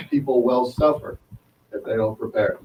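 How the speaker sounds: ordinary talk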